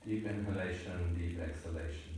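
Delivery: soft, low breath-guiding voice; soft breathing cue. Shown as a demonstration. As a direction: guide the breath in a hushed voice. Teacher cueing a slow breath.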